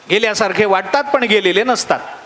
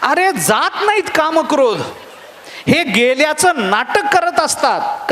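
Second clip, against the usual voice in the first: slow, higher, laughing emphasis; emphatic preaching; 5 semitones higher, slower than usual. Real, not acted. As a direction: drive the moral home slowly. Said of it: "preacher's punchline". (हळू, उंच, हसत भर देऊन)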